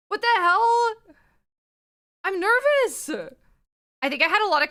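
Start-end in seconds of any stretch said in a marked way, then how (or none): 0.1-3.1 nervous excitement. Real, not acted.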